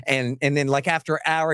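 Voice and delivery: ordinary talk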